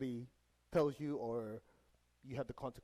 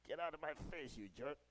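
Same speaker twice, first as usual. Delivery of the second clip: mock irritation; 9 dB quieter than usual. Retelling rude outburst at man in way.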